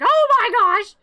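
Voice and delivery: high, loud gasp of alarm; shocked children. Retold or imitated. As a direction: shriek it like startled kids. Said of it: "the children are like".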